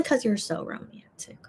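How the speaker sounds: breathy teasing whisper. Real, not acted. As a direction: whisper it as a playful aside. Teasing aside to viewer.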